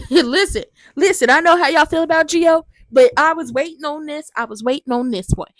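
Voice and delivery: loud, high, gleeful excitement; can't wait to tell you this one; excited. Real, not acted. Host gushing to viewers, loud and thrilled.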